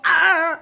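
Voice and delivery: high, forceful "ah" yell; loud cry. A narrator's reading. La L starting over, crying out. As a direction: let out a sustained shout.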